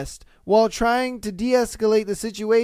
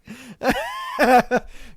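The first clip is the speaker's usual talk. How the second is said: higher, drawn-out burst of laughter; amused laugh; 5 semitones higher than usual. Real, not acted. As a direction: crack up at your own bit. Cracks up at own bit.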